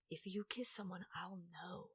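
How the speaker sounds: ominous soft whisper; imitating mom's whisper. Overprotective mother warning her child, whispered threat. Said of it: "she was just like".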